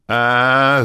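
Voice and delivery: loud, high drawn-out yell; a clerk shrieking in pain; agonized scream. Acted. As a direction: scream as lightning hits. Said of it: "заорал клерк".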